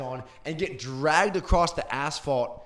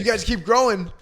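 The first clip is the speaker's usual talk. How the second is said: mock dad voice; 5 semitones higher, 6 dB louder than usual. Imitating her dad.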